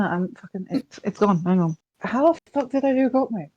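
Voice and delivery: normal talking voice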